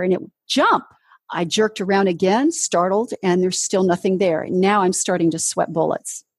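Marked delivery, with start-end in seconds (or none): 0.5-0.8 louder voice. Retold or imitated. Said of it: "it was even louder in the same right ear".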